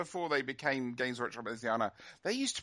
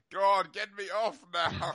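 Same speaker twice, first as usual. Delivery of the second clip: mock weary plea; 5 semitones higher, 5 dB louder, slower than usual. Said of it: "Matt's just going".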